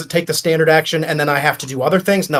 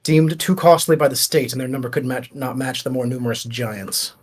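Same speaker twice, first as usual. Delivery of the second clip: game master reading out the lore. Acted. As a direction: read the item's history to the party like a codex entry. Game master reads item lore.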